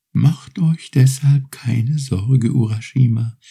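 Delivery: high, gentle turtle voice, unhurried; grateful little turtle. Acted. Turtle thanks Urashima for freeing her, high and gentle. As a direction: offer your thanks like a small polite sea creature.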